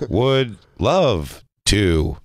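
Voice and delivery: slow, word-by-word robot voice; halting synthesized-speech imitation; Speak and Spell. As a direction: space each word out like a talking machine. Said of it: "Speak and Spell over here".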